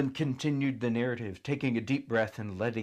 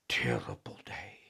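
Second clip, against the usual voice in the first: whispered; 11 semitones lower, 4 dB quieter than usual. A narrator's reading. Grim memory.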